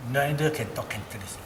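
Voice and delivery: ordinary delivery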